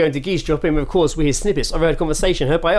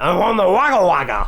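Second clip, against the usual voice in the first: mock Geordie accent, pitched up; local Newcastle man; 5 semitones higher than usual. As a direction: garble it in an exaggerated Geordie accent. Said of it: "he literally went".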